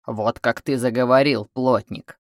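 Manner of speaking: rough man cutting in, slightly low. A narrator's reading. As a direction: butt in gruffly with a jeer. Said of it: "вмешался грубый голос". (грубый голос)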